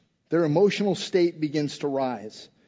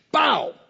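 high, sudden "pow" for a slap; mock slap sound; 8 semitones higher than usual. Preacher acting out an official slapping Jesus, imitated smack.

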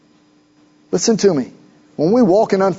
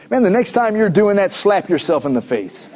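ordinary talk, nothing marked

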